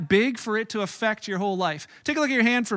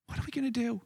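hushed fear; 10 dB quieter, faster than usual. Retold or imitated. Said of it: "He's like".

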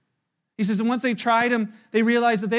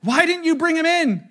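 louder, higher demand to the guards; angry demand; 7 semitones higher, 6 dB louder than usual. Retold or imitated. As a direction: snap at the guards for failing. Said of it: "this group said".

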